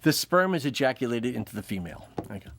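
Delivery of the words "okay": ordinary delivery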